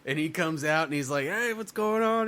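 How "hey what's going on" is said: put-on high, slow sloppy drunk voice; drunk friend. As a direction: slur it like a drunk comic. Comic retelling a drunk friend.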